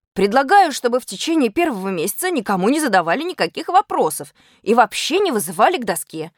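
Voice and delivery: usual speaking voice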